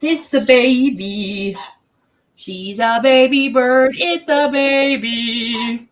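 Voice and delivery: high, slow cooing at the bird. Real, not acted. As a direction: coo it like talking to a pet. Baby talk to pet bird.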